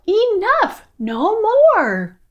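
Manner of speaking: high, exasperated fairy yell; fairies' yell. A narrator's reading. Fed-up fairies to the interrupting prince, frustrated yell. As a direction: cry out at the end of your patience. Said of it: "The fairies yelled".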